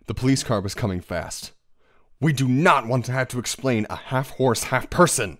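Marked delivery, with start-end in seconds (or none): 2.2-5.4 loud, raised-voice urgency; urgent insistence. A narrator's reading. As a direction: press the point loudly, punching the words. Urgent warning as police approach.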